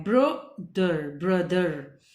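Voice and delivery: ब्रदर slowly split as bruh-der, then joined, low; low stretched ब्रदर, then the blended word; broken then blended. Shown as a demonstration. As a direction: sound out ब्रदर in two parts, then whole. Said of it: "यहां से इसको break किया है".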